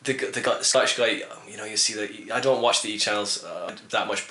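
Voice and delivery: normal talking voice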